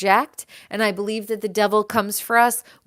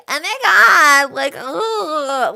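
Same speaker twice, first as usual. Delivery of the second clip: put-on much higher, loud vapid girl squeal; mock a shallow girl gushing then groaning; hammed-up valley girl voice; 6 semitones higher, 5 dB louder, slower than usual. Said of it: "they're like".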